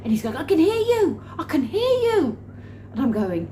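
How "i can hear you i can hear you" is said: high, loud mock calling; hammed-up, excited high voice; excited boss. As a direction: imitate him crying out urgently, pitched way up.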